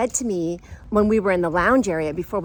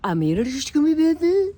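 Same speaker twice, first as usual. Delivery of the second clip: high mock gibberish question; garbled attempt; 6 semitones higher than usual. Nervous man tries his line again, imitated garbled question. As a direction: jumble the words, climbing to a questioning end. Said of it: "He could not get words out".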